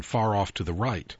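plain reading voice

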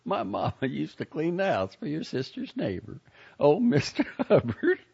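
chuckling through the words; laughing. A narrator's reading. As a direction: laugh while telling it. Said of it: "Joni laughed and said".

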